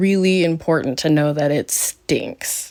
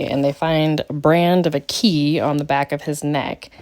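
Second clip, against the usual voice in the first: normal talking voice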